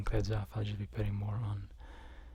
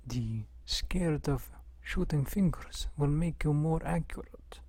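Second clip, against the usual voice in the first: put-on Russian accent; 3 semitones higher than usual. Streamer showing off accents to chat as a Russian with a rifle, Russian accent. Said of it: "Can you do any other accents?".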